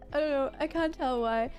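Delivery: ordinary talk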